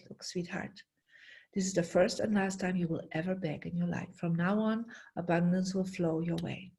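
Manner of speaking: soft hushed comfort to a crying stranger; quiet, caring and sure of it; tender reassurance. Retold or imitated. Tender words to begging woman.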